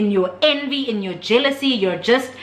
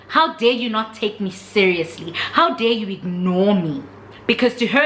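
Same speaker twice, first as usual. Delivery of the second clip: usual speaking voice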